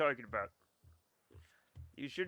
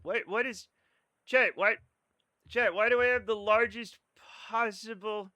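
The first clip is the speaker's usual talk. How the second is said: exasperated whine; 5 semitones higher, 3 dB louder than usual. Real, not acted. Complaining to chat about nerds.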